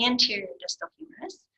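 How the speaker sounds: ordinary talk, nothing marked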